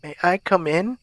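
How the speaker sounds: put-on high old lady voice, rising at the end; elderly woman. A narrator's reading. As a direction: ask like an old woman at the door. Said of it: "asked an elderly woman".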